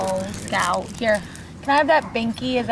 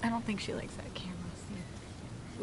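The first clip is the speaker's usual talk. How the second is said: whispering; 5 semitones lower, 15 dB quieter than usual. Real, not acted. Unvoiced, quiet aside.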